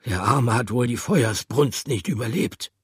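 a hint of mournful character voice; mild sorrow. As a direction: pity the poor creature. Another animal mourns.

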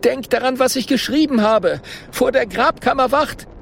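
high, urgent pleading; anxious warning. A narrator's reading. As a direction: warn them in a high, frightened voice. Merere warns friends urgently.

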